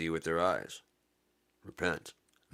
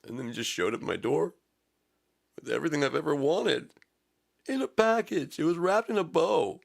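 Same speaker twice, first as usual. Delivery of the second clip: much higher and louder, squealing with glee; giddy excitement; 11 semitones higher, 6 dB louder than usual. Acted. Preacher telling the congregation of his gift, squealing high and loud. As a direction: gush like a thrilled child. Preacher's gleeful climax of skit.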